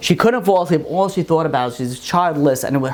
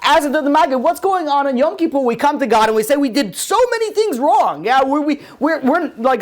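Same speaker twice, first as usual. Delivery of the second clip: high-pitched, urgent sermon delivery; fervent rabbi driving the point home; impassioned preaching; 8 semitones higher than usual. Real, not acted. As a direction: press the moral on the congregation. Preaches the moral of the parable.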